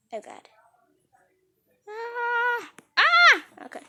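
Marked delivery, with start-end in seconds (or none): 1.9-3.4 long high "ah", then a louder shriek; high scream. Pretend. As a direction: scream out twice, the second piercing. Screams between takes of the story.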